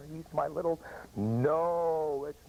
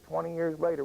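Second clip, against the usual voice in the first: ordinary delivery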